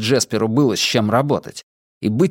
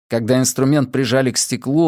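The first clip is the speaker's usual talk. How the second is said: plain reading voice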